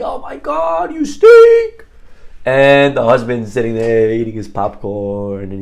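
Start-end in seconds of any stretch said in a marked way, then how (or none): 0.0-1.9 shrill high-pitched imitated scream at the TV; wife losing it over the game, yelling at the screen. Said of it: "she's screaming at the T V".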